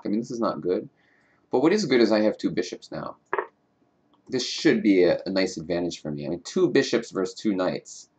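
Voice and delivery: usual speaking voice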